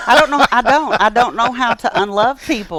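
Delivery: rising earnest exclamation; emphatic outburst. Real, not acted. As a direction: insist you can't stop loving. Emphatic insistence, raised voice.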